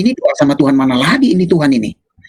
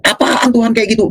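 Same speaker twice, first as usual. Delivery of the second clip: scornful retort; 6 semitones higher than usual. Retold or imitated. Scornful retold question.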